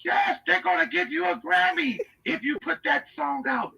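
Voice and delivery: high, gushing promise; Leslie Jennings. Acted. Admirer promising the inmate a Grammy, gushing. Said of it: "doing that Leslie Jennings voice".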